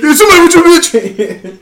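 high, loud accusing shout; mock confrontation at full volume; hammed-up comic yell. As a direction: shout it like a comedian's punchline. Finishes the quoted comedy bit.

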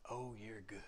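breathy hushed compliment; a gentle, doting whisper; soft whispered praise. Retold or imitated. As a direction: whisper it like a sweet old lady. Sweet old lady praising him.

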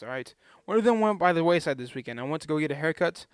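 ordinary delivery

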